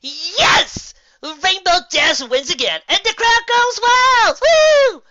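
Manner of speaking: high, loud victory whoop; celebratory cry. Acted. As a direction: crow over the win, slow and gleeful. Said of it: "gave a celebratory cry".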